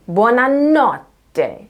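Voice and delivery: BUONANOTTE slow, with a high, long NOT; overemphasized second-to-last stress. Shown as a demonstration. Teacher repeating the example, exaggerated stress on NOT in Buonanotte. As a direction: stretch and lift NOT in buona-NOT-te. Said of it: "stressed on the second to last syllable".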